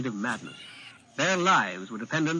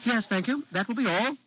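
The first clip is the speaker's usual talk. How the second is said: put-on higher boy prince, relief slipping through; audible relief; 9 semitones higher than usual. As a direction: let the relief leak out. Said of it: "failing to conceal the relief in his voice".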